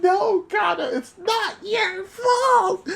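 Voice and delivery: high, drawn-out tearful wail; anguished cry. Real, not acted. Fan pleading to Kana it's not her fault, wailing. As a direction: plead with them, voice climbing into a cry. Crying out to a story character.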